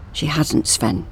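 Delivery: soft quiet counter; quiet insistence. A narrator's reading. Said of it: "countered Lucas".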